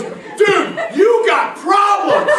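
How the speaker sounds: high, loud outraged yell; loud, high-pitched shriek of disbelief; retold scream. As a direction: scream it as if kicking open a door. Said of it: "he screamed so loud".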